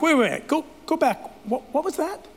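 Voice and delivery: lightly voiced king, startled and pressing. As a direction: play the king suddenly sitting up in shock. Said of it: "he's like".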